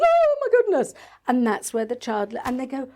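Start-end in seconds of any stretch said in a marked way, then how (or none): 0.0-0.9 mock shriek at a spider, much higher and louder; mock scream. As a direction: squeal it like a frightened mum. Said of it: "mum screams and goes".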